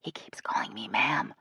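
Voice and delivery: low, soft whisper. A narrator's reading. Jill grumbling to Shay about the guide, whispered. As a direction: whisper the complaint confidentially. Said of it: "leaned close to Shay and whispered".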